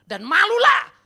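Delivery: high, loud command; loud rebuke. Real, not acted. Preacher rebuking the congregation, loud and high. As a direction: fling the rebuke at them.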